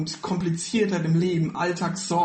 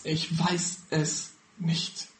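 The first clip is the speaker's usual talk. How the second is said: slow confession; 5 dB quieter, much slower than usual. Acted. Preacher admits not knowing, drawn out.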